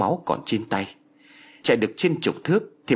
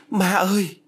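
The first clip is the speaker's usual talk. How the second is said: high-pitched, anguished scream for mother; screamed cry; 10 semitones higher, 3 dB louder than usual. A narrator's reading. Battered girl comes to and calls her mother, screaming. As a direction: cry out for mom in pain, pitched way up. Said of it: "con bé gào lên".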